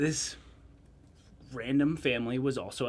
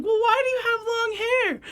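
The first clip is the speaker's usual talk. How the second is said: put-on a curious small child asking, pitched way up; little kid voice; 4 dB louder than usual. Storyteller voicing small boy at hot springs, high child voice.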